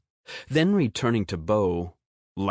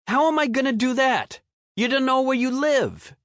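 put-on high, fast scornful kid voice; exasperated boy; 4 dB louder, faster than usual. A narrator's reading. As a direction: scoff like an annoyed boy. Bo scoffs at the boy.

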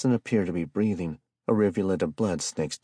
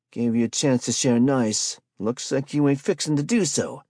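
higher, louder, cold menace; menacing street hustler; 8 semitones higher, 6 dB louder than usual. A narrator's reading. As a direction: threaten him coolly. Spike voiced, menacing.

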